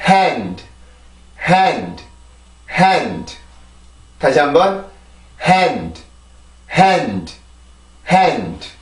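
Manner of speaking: 'Hand' with H breath leading into a falling vowel; one stressed syllable 'hand', d released without a vowel; English pronunciation drill. Shown as a demonstration. Teacher drilling learners after explaining H, one-syllable 'hand'. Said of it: "자, 발음해 볼게요".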